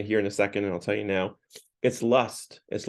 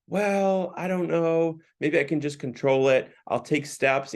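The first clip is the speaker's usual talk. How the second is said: higher-pitched, mock-reluctant hedging; hesitant stalling; 6 semitones higher than usual. Addicts putting off the offer to kill it, mock hesitant. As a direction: imitate someone wavering, starting high and easing down.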